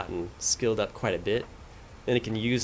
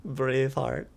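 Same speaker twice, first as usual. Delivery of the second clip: slow, amused laugh through the word Braveheart; chuckling repeat of Braveheart; 4 semitones higher, slower than usual. Real, not acted. Player laughing as he repeats the game's Braveheart line.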